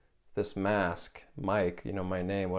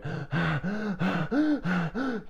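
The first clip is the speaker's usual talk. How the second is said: high, loud sobbing gasp; reliving the sob with a gasp; 11 semitones higher, 4 dB louder than usual. Retold or imitated. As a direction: gasp like you are sobbing hard. Retelling sobbing, gasps.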